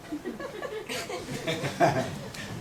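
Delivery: a very high, quiet wheeze of laughter; high soft laugh. Real, not acted. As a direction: laugh softly, high and breathless. Laughs at the failures story.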